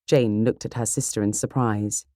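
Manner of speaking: ordinary delivery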